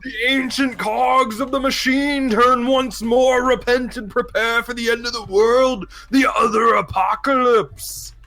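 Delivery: high, slow street-preacher holler; doomsday shouting. Acted. Bedraggled man warns the street of the apocalypse, shouting. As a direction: cry it out to the whole street, drawn out. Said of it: "he's just shouting at the top of his lungs".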